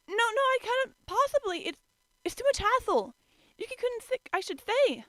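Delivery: high, loud, flustered protest; flustered girl. A narrator's reading. Toru refusing the ride, high flustered girl voice.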